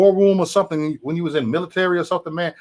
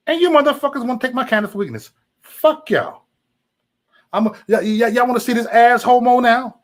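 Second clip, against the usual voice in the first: angry rant; 8 semitones higher, 4 dB louder than usual. Real, not acted.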